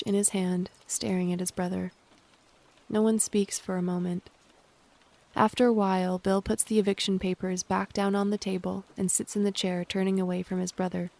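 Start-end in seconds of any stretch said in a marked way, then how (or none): none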